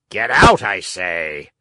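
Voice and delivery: loud burst dropping to a low growl; threatening shout. A narrator's reading. Fisherman driving off the begging dog, threatening. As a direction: shout the order, then bear down low. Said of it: "repeated the fisherman".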